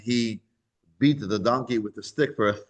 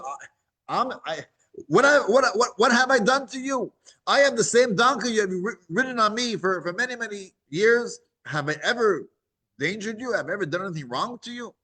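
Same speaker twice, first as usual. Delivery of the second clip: high, flustered pleading voice; the loyal donkey protests to its angry rider; stammering donkey; 8 semitones higher than usual. Acted. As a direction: stumble over the words, hurt and pleading.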